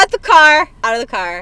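loud burst, then easing off; mock ordering out. Dropping a friend over SpongeBob, mock outraged order. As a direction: playfully throw a friend out, loudly first.

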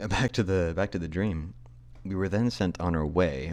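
usual speaking voice

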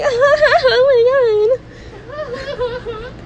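very high, drawn-out giggly squeal; mock giddy squeal. Friends hearing of a coffee invite, mock giddy squeal.

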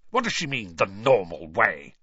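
spitting the words out in a different gruff voice; contemptuous snarl at the letter; spat. A narrator's reading. Uncle scorning the letter's wording, spat out angrily. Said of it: "he spat".

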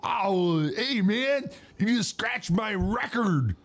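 high, spaced-out stoner voice; Chong impression. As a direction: do a lazy Cheech and Chong stoner. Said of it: "I used to do a good Chong impression".

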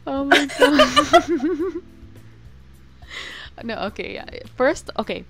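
normal talking voice

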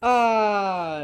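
long, high, loud falling "aah"; hammed-up death wail sliding downward; mock dying cry. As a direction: cry out like a target keeling over. Game master acts target dying.

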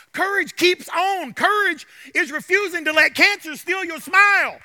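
loud, driving sermon delivery; fervent preaching. Real, not acted. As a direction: preach it with mounting conviction, punching key words. Preaches at full force.

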